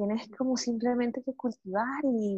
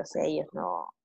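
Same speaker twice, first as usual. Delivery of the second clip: whisper; 6 semitones lower, 6 dB quieter than usual. Real, not acted. Soft aside about babies.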